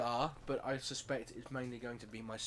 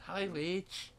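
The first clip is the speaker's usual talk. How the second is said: lightly imitating a faux French lady; a hint of a mock French greeting; faux French accent; 6 semitones higher than usual. Retelling the game's office lady greeting in a faux French accent. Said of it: "in a faux French accent".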